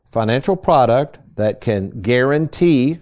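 slow, deliberate weight on "guarantee"; stress on guarantee. Real, not acted. Host setting a quiz question, "guarantee" stressed slowly. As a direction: spell out "guarantee" slowly and emphatically. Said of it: "I'm emphasizing the word guarantee".

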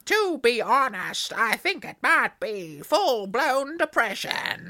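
slow mock-American drawl; cheerfully overdone American accent. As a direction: read the letter in a hammed-up American accent. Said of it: "maybe stop doing the accent".

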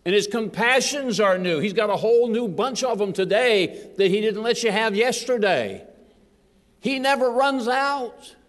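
high, loud, ringing proclamation, slowing hard on the last line; full-force preaching. Acted. Preacher to his church, proclaiming with full force. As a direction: preach it out over the congregation.

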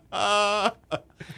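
cracking up through the words; high, drawn-out words dissolving into real laughter; laughing. Real, not acted. Cracks up at the title mix-up.

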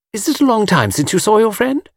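put-on high, girlish questioning voice; inquisitive admirer. A narrator's reading. Miss Taylor resuming her catechism, inquisitive. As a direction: probe sweetly in a high voice. Admirer quizzing Mr Cade, acted.

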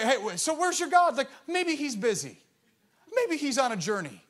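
high mocking taunt, lightly played; sarcastic. Elijah mocking Baal's prophets, playful sarcastic taunt. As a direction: tease them like a smug sibling. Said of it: "he's really being sarcastic".

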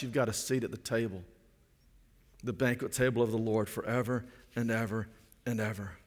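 usual speaking voice

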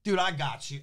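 high, pushy street hustler voice; mock hustler pitch. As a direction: sell it like a slick recruiter. Hustler luring kid at airport.

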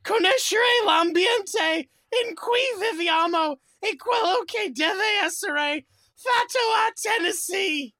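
slow, loud, high fake Italian declaiming; high, loud mock Italian with a crying edge; mock Italian proclamation. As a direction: ham it up like an opera tenor in Italian.

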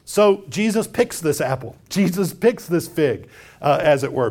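usual speaking voice

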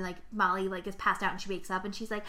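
ordinary talk, nothing marked

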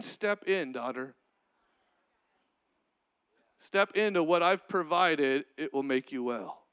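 dropping low and soft; Jesus inviting the healed daughter, soft and low; tender invitation. Acted. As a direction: coax her gently in, low.

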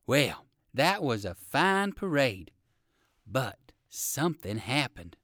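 ordinary delivery

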